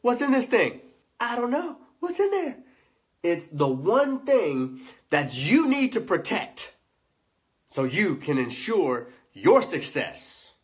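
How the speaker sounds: high, drawn-out showman's opening; hyped teaser intro. Acted. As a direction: tease the mystery loudly, stretching key words.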